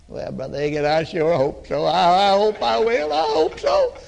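wavering hope, climbing up; doubtful hoping. Retold or imitated. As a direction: play someone who is not sure at all. Unsure sick man answering preacher.

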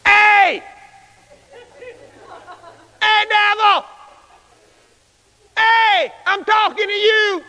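high, emphatic shout at the devil; calling out boldly. Acted. Preacher hailing the devil in the street, bold high call. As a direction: holler a challenge at someone down the street.

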